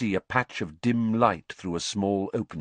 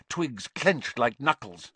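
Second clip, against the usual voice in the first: usual speaking voice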